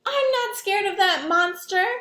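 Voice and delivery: high, loud insistent voice; insistent kid. Acted. Sam insists to the monster he's not scared, high kid voice. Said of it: "replied Sam".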